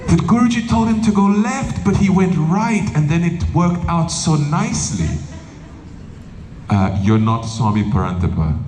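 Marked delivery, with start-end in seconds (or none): 0.1-5.1 high-pitched mock-earnest storytelling; excuse-making devotee. Devotee cites another swami's story to excuse himself, mock-earnest.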